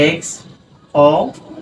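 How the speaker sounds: এক্সপোজার stretched very slowly, split into parts; drawn-out syllables. Shown as a demonstration. Teacher breaks এক্সপোজার into syllables, very slow. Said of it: "এভাবে ভাঙা যায়".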